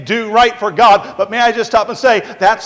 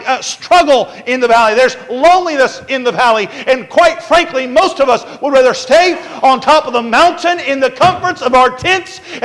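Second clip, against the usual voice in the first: raised pitch, driving rhythmic sermon delivery; forceful preaching; 6 semitones higher, 6 dB louder than usual. Real, not acted. Preacher warning of pain in the valley, building forcefully. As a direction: build higher and harder through each repeated phrase.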